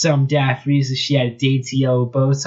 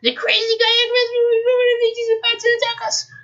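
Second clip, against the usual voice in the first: much higher panicked squeak; squeaky. Acted. Frightened Nicole bursts in to warn the royals, squeaking. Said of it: "she squeaked".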